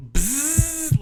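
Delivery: high, drawn-out buzzing; imitated wasp buzz. Storyteller imitating wasps rising from the bush, high buzz.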